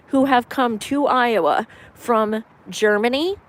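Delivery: ordinary delivery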